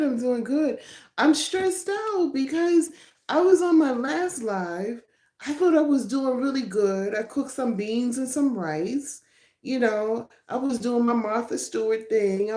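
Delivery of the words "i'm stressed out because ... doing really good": higher, animated venting. Real, not acted. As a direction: spill it out all worked up. Lively complaint about last live.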